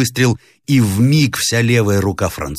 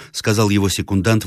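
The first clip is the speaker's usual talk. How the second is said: plain reading voice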